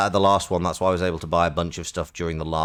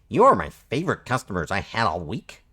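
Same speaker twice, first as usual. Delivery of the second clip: sentences swooping up like questions; chummy Canadian shopkeeper with rising endings; Canadian slug merchant, questioning lilt; 3 semitones higher than usual. Acted. Slug merchant saying bye to favorite customers, Canadian question-like lilt. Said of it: "adding question marks to the end of his sentences".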